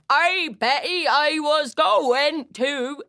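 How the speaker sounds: slow, stretched Yorkshire shout; Yorkshire accent. Acted. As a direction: call broad Yorkshire vowels across a field. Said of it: "their big broad vowels".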